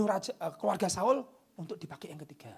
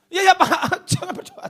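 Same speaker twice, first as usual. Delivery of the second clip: laughing mock plea; 17 dB louder than usual. Mock reply to the demon.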